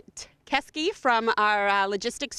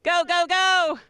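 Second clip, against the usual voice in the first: cheering; 6 semitones higher, slower than usual. Real, not acted. Cheering on eating contestants.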